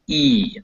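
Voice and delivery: "E" as in peas; long ee vowel. Shown as a demonstration. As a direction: sound out "E" with the tongue up near the front roof. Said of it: "like in read or beat or peas".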